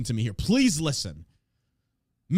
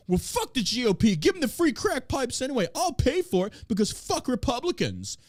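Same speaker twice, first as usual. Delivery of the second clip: higher mock voice of a spiteful partisan; mocking Democrats; 7 semitones higher than usual. Host imitates stubborn Democrats reacting to GOP, higher mock voice. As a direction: parody a petty voter, lightly hammed. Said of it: "It's like".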